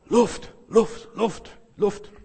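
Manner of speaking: high, gasped pleas for air; groaning for air. Acted. Young man held under water craving air, groaning. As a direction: gasp "Luft" like someone nearly drowned. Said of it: "Stöhnte der junge Mann".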